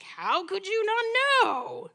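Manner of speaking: high, slow "how could you not know"; imitating an amazed look in her voice; mock amazement. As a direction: play the disbelieving wife. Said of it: "a look of amazement".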